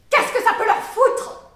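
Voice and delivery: high, very loud indignant shouting; furious outburst. Acted. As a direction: explode at their prying questions. Outburst at prying questions about childhood.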